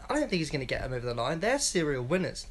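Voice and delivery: ordinary talk, nothing marked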